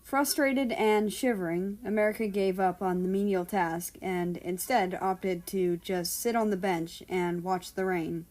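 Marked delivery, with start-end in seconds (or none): none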